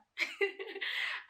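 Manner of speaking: high, loud burst of laughter; embarrassed laugh. Real, not acted. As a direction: laugh it off after crying. Laughing at her own tears.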